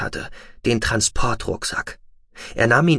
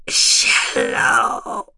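faint breathy gasp of his name; breathed. Acted. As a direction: barely breathe it out. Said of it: "hauchte sie".

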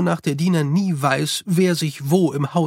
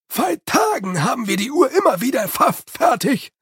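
grim, grumbling Igor voice, pitched up; darkly; 9 semitones higher than usual. A narrator's reading. Igor complaining darkly to Jeremy about the clock. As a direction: sour and reproachful about the clock. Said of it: "sagte Igor finster".

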